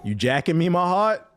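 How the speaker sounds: light mock question, rising at the end; quoting New York slang. The streamer quoting the slang to chat, lightly mocking. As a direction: toss it off as a half-voiced quote. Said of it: "quoting New York slang".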